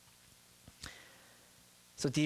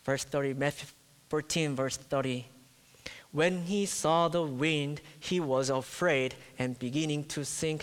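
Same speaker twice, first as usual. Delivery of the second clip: ordinary talk, nothing marked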